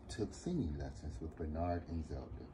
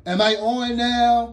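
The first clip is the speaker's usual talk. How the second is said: put-on high, slow, loud kid voice; 17 dB louder than usual. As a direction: boom the question out. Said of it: "always loud".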